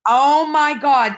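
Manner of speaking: awed exclamation. Retold or imitated. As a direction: let the wonder stretch out, loud and slow. Reacting to stranger's words.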